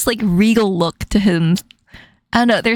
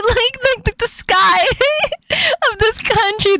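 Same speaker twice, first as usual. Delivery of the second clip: giggling through words; laughing; 11 semitones higher than usual. Real, not acted. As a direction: laugh while saying it.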